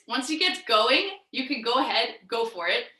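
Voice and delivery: ordinary talk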